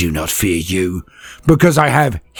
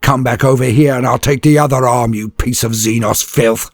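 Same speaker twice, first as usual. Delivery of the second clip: loud, higher snarled threat; defiant trooper threat; growl; 5 semitones higher, 4 dB louder than usual. Acted. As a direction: growl the challenge at the orc. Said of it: "he growls".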